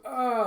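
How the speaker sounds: high, loud cry; short loud "ah" yell; acted yell. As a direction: cry out suddenly. Acted cry in fight scene.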